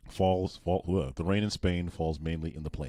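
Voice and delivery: careful attempt at American English; imitated American accent. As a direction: try the American vowels, restarting on falls. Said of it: "That sounds really good".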